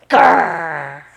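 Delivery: low, loud growl; dog in the shopping cart growling. A narrator's reading. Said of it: "a most uncatlike growl".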